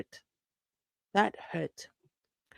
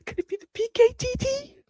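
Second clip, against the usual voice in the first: high-pitched panicky question; gasp, then ask in a high, alarmed voice; spooked, voice shooting up; real alarm. Real, not acted. Spooked by sounds in the house.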